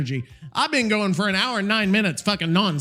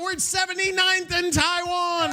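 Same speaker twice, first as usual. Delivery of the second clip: excited shout; 11 semitones higher than usual. Real, not acted. Excited announcing chart ranking.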